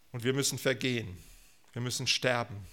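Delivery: usual speaking voice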